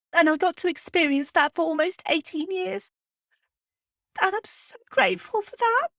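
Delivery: real tears held back; emotional. Real, not acted. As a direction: voice rising and cracking with grief. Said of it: "this is not the first time that I've got emotional".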